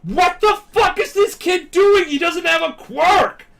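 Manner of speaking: high, loud incredulous shout; hammed-up outraged scolding; outraged yelling. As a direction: yell it drawn out in acted disbelief. Acted outraged onlookers berating a kid.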